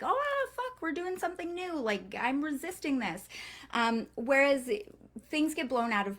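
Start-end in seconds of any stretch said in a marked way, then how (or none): 0.0-3.3 high-pitched mock alarm, easing down; panicky overthinking brain. Brain balking at doing something new, high mock panic. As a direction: voice a resisting brain, pitch jumping up. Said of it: "brain's way of being like".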